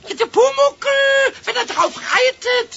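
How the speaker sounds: high, loud proud cry; gleeful boast. Acted. Pumuckl telling Eder he will ride goats, gleeful. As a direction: announce yourself as the rider.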